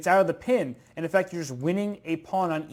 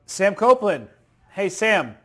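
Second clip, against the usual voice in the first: raised voice, higher and louder; calling out; 4 semitones higher, 6 dB louder than usual. Real, not acted. Commentator checks whether Sam is there, calling out. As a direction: hail someone who may not hear. Calling an absent colleague on air.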